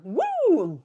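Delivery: usual speaking voice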